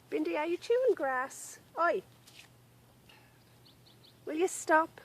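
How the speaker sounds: scolding the dog. Real, not acted. Owner to dog chewing grass, scolding. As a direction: catch a pet misbehaving and check it.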